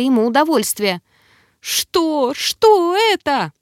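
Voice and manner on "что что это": high-pitched, drawn-out distressed cry; alarmed. A narrator's reading. Girl in agony asks what is happening, alarmed. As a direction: demand it in a high, pained voice.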